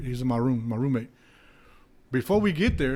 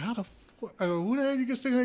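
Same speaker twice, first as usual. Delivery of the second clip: high disbelieving outburst; can't believe the sum; incredulous; 10 semitones higher than usual. Retold or imitated. Retold disbelief at roommate.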